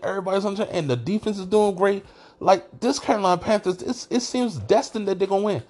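high-pitched, incredulous case-making; retold protest. Retelling his argument with wife.

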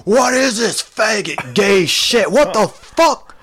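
high, loud outraged yell; imitated screaming customer. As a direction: rant at the top of your range as an irate customer returning a tape. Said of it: "return it fucking screaming, like".

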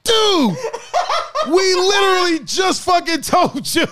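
high, laughing, exasperated shout. Retold or imitated. Herb yelling at John that they just told him, exasperated laughing shout. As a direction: yell it through laughter, fed up. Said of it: "Herb is like".

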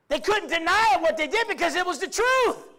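high, loud sermon declaration; forceful pulpit delivery; preaching at full force. Acted. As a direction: proclaim it to the room.

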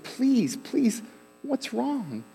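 pleading mom. Retold or imitated. Mom begging her sobbing son to speak, high pleading. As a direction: plead with him gently, voice lifted high.

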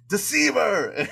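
high, loud mock cry of accusation; retold yell. Sorsha retold catching her suitor out, mock accusing yell. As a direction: yell it like a betrayed movie heroine. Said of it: "and she yells".

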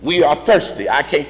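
preacher working up to an impassioned peak; building preaching. Real, not acted. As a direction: start restrained, climb in pitch and intensity with each refrain. Sermon litany building to shout.

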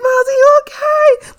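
high, loud, panicky call to the screen; imitating a scared fan calling out; worried call. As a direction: shout your worry at the TV. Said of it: "I'm like".

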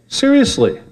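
a sudden high incredulous yelp. Real, not acted. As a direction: jump up in disbelief. Incredulous aside.

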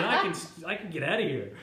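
retelling his own thought through loud laughter; laughing quote. Retold or imitated.

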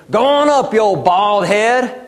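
lightly voiced kid heckling a bald man; mocking thugs. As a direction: jeer at the old prophet like a street punk. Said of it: "they start mocking him".

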